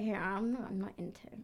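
low, whispered aside; hushed, acted brush-off; confiding refusal. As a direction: drop to a whisper to decline discreetly.

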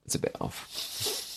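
slow, breathless whispered repeat; whispered echo. Acted. Guest echoing the host's complaint in a whisper. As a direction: whisper it back as a joke.

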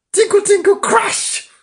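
high, loud tinkle and crash; piano crashing. A narrator's reading. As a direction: sing out the clanging piano keys at full pitch. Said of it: "Went the notes on the piano".

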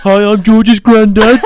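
high, loud, slow silly granddad; mock granddad. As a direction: joke impersonation of a dead grandfather. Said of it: "come in with a little mask of them on".

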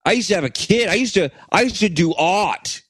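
hammed-up Chicago accent. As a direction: put on a loose Chicago pastiche. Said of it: "Chicago guy".

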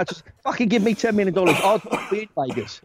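ordinary talk, nothing marked

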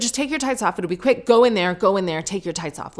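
high, insistent mock ordering; pushy. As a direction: imitate a bullying salon worker rushing someone. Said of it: "almost shoved me into the loo".

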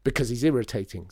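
loud, irritated snap; snapping. A narrator's reading. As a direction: snap back at the grown-up. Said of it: "snapped Lydia".

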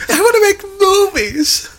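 put-on high whining kid pleading; whimpering child begging to make films; whiny child voice.

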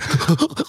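high put-on henchman chuckle; imitated cartoon minion cackle; underlings' sycophantic laugh. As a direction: fake laugh at the boss's jokes. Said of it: "two of them going".

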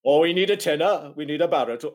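exaggerated Welsh chorister voice; mock Welsh accent. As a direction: put on a bad lilting Welsh accent. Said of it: "Oh, well, Welsh".

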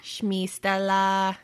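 put-on slow, drawn-out Russian accent; fake Russian accent. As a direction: stretch it like a Russian. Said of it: "in my fake Russian accent".

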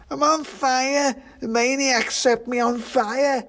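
very high, drawn-out wail; drunken pudding crying out in alarm; panicked shouting pudding. A narrator's reading. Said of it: "shouted the pudding".

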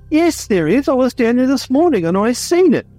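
put-on high, strained old farmer voice; old bloke voice. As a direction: play an insistent old country bloke. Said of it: "This sounds just like a typical old bloke conversation".

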